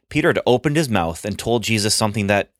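usual speaking voice

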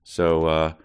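ordinary talk

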